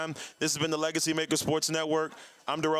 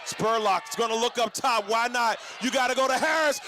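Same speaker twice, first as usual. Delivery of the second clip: building play-by-play; 3 semitones higher than usual. Real, not acted. Announcer calling the final play to listeners, building excitement. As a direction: build the call toward the catch. Live call of last play.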